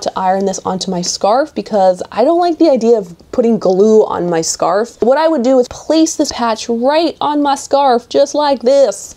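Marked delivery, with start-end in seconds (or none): 5.0-9.1 put-on accidental Southern US accent, a little higher; light Southern twang, stretched at the end. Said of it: "talking with a southern accent".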